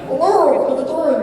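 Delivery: high, silly cartoon greeting; little chicken monster. Actor turning round to meet a castmate, high chicken monster. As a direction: greet them in a squeaky creature voice. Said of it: "little chicken monster person I was doing".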